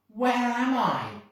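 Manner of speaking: high, slow wondering question; amazed. Acted. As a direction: gaze around in amazement. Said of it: "he said".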